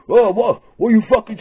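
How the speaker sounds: high, loud confrontational snarl; loud high street challenge; aggressive challenge. Retold or imitated. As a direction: square up to them. Blokes confronting the thugs.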